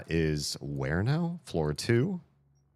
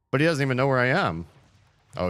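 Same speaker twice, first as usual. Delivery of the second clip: normal talking voice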